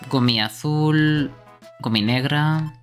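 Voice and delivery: ordinary talk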